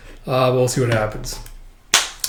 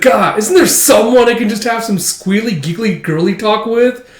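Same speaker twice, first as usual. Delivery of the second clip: put-on exasperated girly whine; 6 semitones higher, 3 dB louder than usual. Frustrated Becky voiced.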